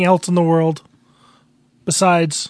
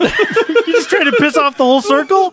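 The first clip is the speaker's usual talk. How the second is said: laughing; 9 semitones higher, 4 dB louder than usual. Real, not acted. Laughing at friend's joke.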